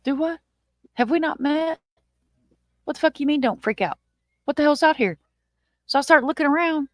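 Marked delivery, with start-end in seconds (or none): 0.0-5.2 higher, incredulous panic at him; mock-panicked what the hell; alarmed disbelief. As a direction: demand to know what's out there. Panicked reply to don't freak out.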